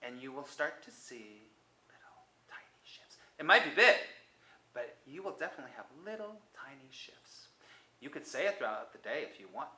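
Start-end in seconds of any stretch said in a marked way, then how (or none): 1.9-3.2 breathy whisper on the small words. Real, not acted. Teacher whispering a promise to viewers. 4.7-7.5 breathy whisper on the small words. Real, not acted. Teacher whispering a promise to viewers.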